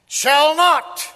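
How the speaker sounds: loud, high burst on "shall not"; thundering declaration. Real, not acted. Preacher insisting hell will fail, thundering. Repeats it at full force.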